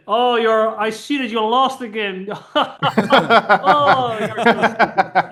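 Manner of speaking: loud, laughing mock insult; best friend laughing at the loss; laughing tease. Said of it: "he said".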